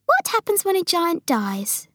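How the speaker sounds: high voice of Sophie; Sophie curious. Acted. Sophie asking what happens when giants die, curious.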